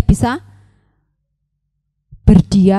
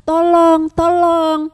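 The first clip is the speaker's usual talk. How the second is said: cry for help; 6 semitones higher, slower than usual. A narrator's reading. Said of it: "teriak minta tolong".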